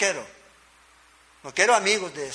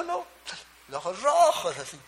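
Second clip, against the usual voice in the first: a hint of a wife brushing it off; mock 'no, just red eyes' denial; lightly voiced wife denying tears; 3 semitones higher than usual. Retold wife quote.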